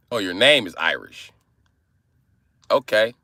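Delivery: normal talking voice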